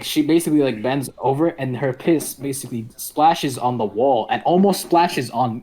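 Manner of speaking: normal talking voice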